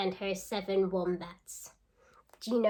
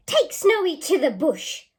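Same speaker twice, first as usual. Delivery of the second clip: a touch of a harsh command, higher and louder; cruel order; 8 semitones higher, 10 dB louder than usual. Acted. Mean queen ordering the stockman to take Snowy away.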